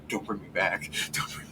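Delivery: soft, defeated summoned creature; hushed, resigned little plea; quiet mock plea. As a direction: plead very quietly not to return. Doomed summoned elemental begs summoner.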